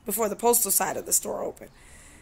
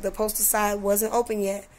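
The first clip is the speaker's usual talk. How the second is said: normal talking voice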